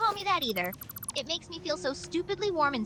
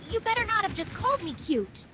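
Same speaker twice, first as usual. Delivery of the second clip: higher, indignant demand; flustered indignation; 8 semitones higher than usual. Acted. Girl hearing herself called cute, demanding indignantly. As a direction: bristle with embarrassed outrage.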